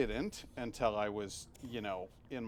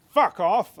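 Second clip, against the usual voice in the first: much louder, higher mock shout; shouted quote; 9 semitones higher, 15 dB louder than usual. Moss caught with trousers down, shouting at visitors. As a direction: yell it at the intruders. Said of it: "He just goes".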